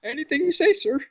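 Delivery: high, squeaky yes-man voice; mock groveling. The host joins the teasing, in a high, groveling mock voice. Mocking the fan's deference.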